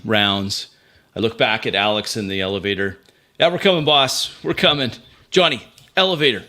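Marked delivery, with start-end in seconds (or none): 3.4-6.4 higher, urgent call to teammates; acted calling out. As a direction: call back as if across the action. Calling back to boss and teammate.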